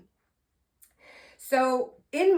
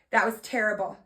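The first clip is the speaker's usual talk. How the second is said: ordinary talk, nothing marked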